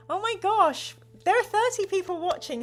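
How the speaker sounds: high, loud, thrilled exclamation; real delight. Real, not acted. Host thrilled by thirty viewers, high and loud. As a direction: gush at the crowd's size.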